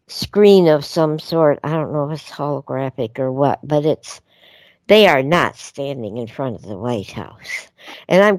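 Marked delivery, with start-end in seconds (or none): none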